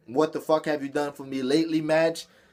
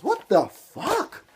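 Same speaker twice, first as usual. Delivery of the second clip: incredulous what the fuck; 9 semitones higher, much slower than usual. Real, not acted. Outraged at booking results.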